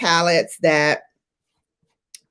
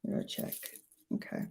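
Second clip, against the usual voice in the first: whispered mirror check; 22 dB quieter, much faster than usual. Real, not acted. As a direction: breathe it out unvoiced. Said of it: "Gotta get my mirror".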